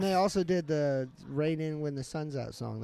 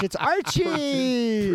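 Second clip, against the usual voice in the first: drawn-out announcement; 7 semitones higher, much slower than usual. Acted.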